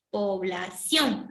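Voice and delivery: Población slow, the stress on the last syllable ción; drawn out Población, landing on ción. Shown as a demonstration. Teacher showing the correct stress on Población, slowly. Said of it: "Ah, ahora sí suena bien".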